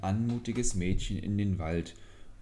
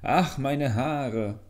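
slow, high, loud wail; the voice in the bamboo cries out as it is split; crying out in pain; 6 semitones higher, 5 dB louder, much slower than usual. A narrator's reading. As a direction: draw out the cry as the blade nears. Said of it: "hörte es wieder die Stimme rufen".

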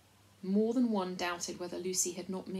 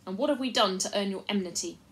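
loud formal demand, pitched up at the start; louder voice; 3 semitones higher, 7 dB louder than usual. A narrator's reading. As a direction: address an unseen crowd. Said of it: "added in a louder voice".

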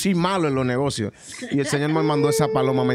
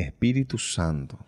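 oración; much slower than usual. Retold or imitated. Oración mental lenta.

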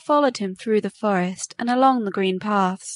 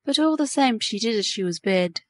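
usual speaking voice

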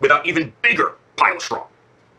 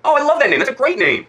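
delighted gushing; 11 semitones higher, 4 dB louder than usual. Real, not acted. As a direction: gush over it, pitched well up. Parent gushes over child's glowworm.

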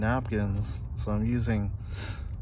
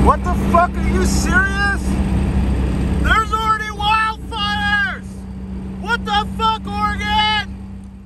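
high, loud disbelieving yell; shocked outburst; 6 dB louder, slower than usual. Real, not acted. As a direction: shout it in stunned disbelief. Disbelief at wildfires while driving.

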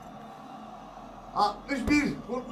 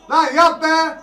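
high, loud yell at the player; urging yell; 6 semitones higher, 9 dB louder than usual. Real, not acted. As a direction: shout to make him do it. Şut için bağırıyor. (oyuncuya bağırarak teşvik)

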